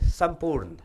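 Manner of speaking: संपूर्ण ending dead on the n, sampoorn; final vowel dropped. Shown as a demonstration. Teacher showing a Bihari mistake, संपूर्ण ending on bare n. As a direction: cut संपूर्ण off without a final a. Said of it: "लेकिन नहीं बोलते".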